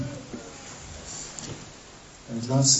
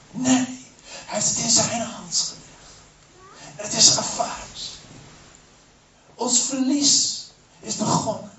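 horrified demons; 9 semitones higher than usual. Acted.

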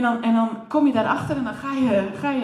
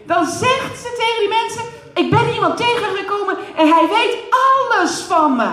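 loud, high, fervent preacher voice; preaching at full force; 6 semitones higher, 5 dB louder than usual. Acted. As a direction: preach it out, rising with joy. Preaching at full force, story climax.

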